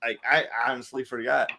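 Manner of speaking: usual speaking voice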